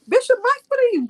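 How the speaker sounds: high, surprised greeting; surprised call-out. Real, not acted. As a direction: call his name in surprise. Calling out on joining.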